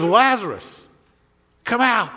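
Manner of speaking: mild acted cry. Preacher re-enacts Jesus calling Lazarus out, mild cry. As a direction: call it out as a restrained holler. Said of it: "he screams out three words".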